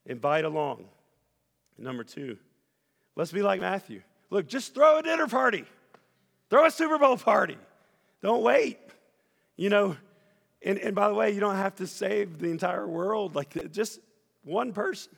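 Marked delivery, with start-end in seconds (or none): 3.2-15.1 much higher and much louder fiery preaching; impassioned pulpit shout; full-force preaching. Acted. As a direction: urge the crowd on, almost shouting.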